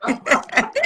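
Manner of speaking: high-pitched acted acceptance; retold pleased reaction. Recalling her response to young fans, high and playfully acted.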